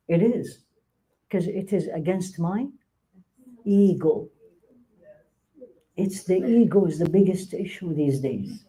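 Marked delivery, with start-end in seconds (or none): none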